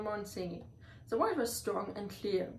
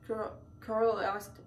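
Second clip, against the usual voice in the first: usual speaking voice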